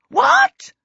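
very high, loud shriek of disbelief. A narrator's reading. Kobolds react to astonishing news, high loud shriek. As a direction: squeal it out in shock. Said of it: "shrieked all the other kobolds".

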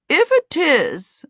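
high, slow, pronounced opinion; opining aunt. A narrator's reading. Aunt Sempronia judging the little guard dog, opining. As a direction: give your verdict as a lofty aunt. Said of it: "Aunt Sempronia opined".

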